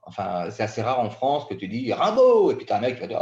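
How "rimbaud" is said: high, loud exclamation; shouted name. Retold or imitated. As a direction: belt the name out. Imagined shouting poet's name.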